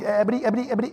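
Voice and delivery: soft cartoon stutter, lightly imitated; Porky Pig. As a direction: stammer on repeated syllables like a cartoon pig. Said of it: "I felt like Porky Pig".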